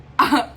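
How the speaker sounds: loud burst of laughter; short laugh. Real, not acted. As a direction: let out a quick laugh. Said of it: "it was funny to me".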